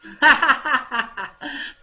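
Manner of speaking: loud, high burst of ha-ha-ha; demonstrative laugh. Shown as a demonstration. Teacher acting out a word for pupils, loud laugh. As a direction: laugh out loud on cue for the class. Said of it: "Uma risada".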